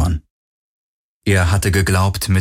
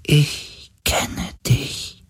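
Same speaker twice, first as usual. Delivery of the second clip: very slow, high, half-whispered spirit; dying creature's voice; 8 semitones higher, 4 dB quieter, much slower than usual. A narrator's reading. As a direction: let it float out faintly, like a dying being. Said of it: "Die Stimme eines sterbenden Wesens". (schwache Stimme eines sterbenden Wesens)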